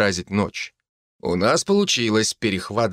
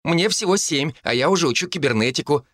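put-on little girl boasting, higher; proud child showing off; boastful; 7 semitones higher than usual. A narrator's reading. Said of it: "похвасталась она".

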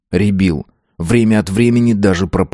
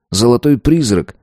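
ordinary delivery, nothing marked

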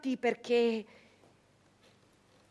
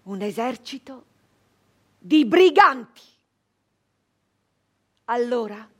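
usual speaking voice

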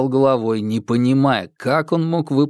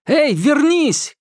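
put-on much higher, slow call after the running girl; shouted call; 3 dB louder, slower than usual. A narrator's reading. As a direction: yell after her down the corridor. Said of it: "крикнул Марк на бегу".

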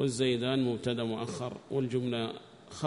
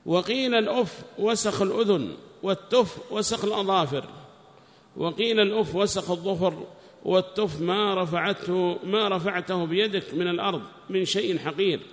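high, loud recitation of a text; declaimed scholarly reading; reading aloud; 7 semitones higher, 7 dB louder than usual. Acted. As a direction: read it out loudly, pitched well up. Reads the book aloud.

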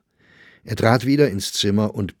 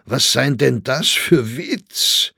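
higher, louder teasing Viennese crony; teasing Grossinger; 4 semitones higher, 5 dB louder, slower than usual. A narrator's reading. Grossinger teases Saxberger about his absence, mock-jovial. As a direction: needle him with a knowing laugh.

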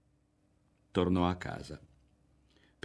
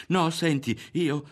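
stammering; 9 semitones higher, 5 dB louder, slower than usual. Acted. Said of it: "balbettò lui".

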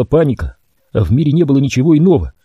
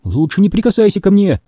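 high, firm warning; 8 semitones higher than usual. A narrator's reading. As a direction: warn him off. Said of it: "предупредила Темпл".

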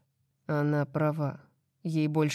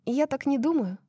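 teasing woman's voice; 10 semitones higher than usual. Acted. Paedyn's voice continuing.

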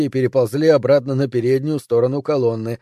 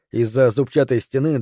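usual speaking voice

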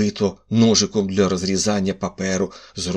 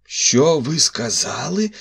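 higher, slow, shocked shout; shouted question; 8 semitones higher, slower than usual. A narrator's reading. Commissioner shouting at the narrator in disbelief. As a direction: demand it again in disbelief. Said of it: "закричав комісар".

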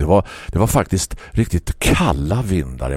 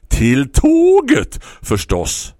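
much higher, louder slow call; shouting back; 7 semitones higher, 5 dB louder, much slower than usual. Acted. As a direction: yell the obvious answer back. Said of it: "skrek grannen tillbaka".

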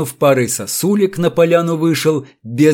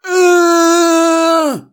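much higher, long menacing wail; sinister drawn-out aah; 5 dB louder than usual. A narrator's reading. Electrician realising who's to blame, menacing drawn-out cry. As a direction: stretch it out with menace. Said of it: "зловеще протянул он".

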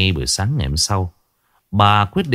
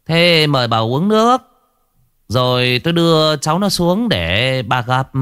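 sugary false hospitality, pitched higher; fake politeness; 7 semitones higher than usual. A narrator's reading. Hoài offering drugged tea to the grandmother with false politeness. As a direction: offer the tea with hollow courtesy. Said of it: "giọng đãi bôi".

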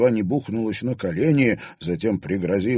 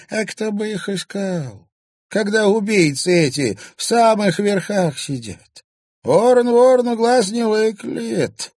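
put-on spiteful old crone; 13 semitones higher, 6 dB louder than usual. A narrator's reading. Old woman rails against the powerful, high and spiteful. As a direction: spit out the proverb. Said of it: "злобно проговорила старуха".

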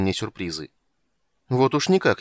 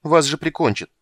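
higher, louder heated outburst; 7 semitones higher, 7 dB louder than usual. A narrator's reading. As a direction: blurt it out hotly. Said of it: "горячо сказала она".

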